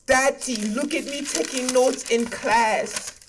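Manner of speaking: put-on slow, eager little kid voice; proud child. Kid showing dad his class notes, slow eager child voice. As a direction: play a kid showing off to daddy.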